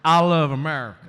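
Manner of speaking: loud patriotic punchline through laughter; Rick Perry impression. Mock Perry invoking national pride, loud and laughing. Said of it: "he'd be up there going".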